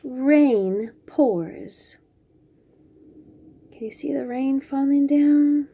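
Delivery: ordinary delivery, nothing marked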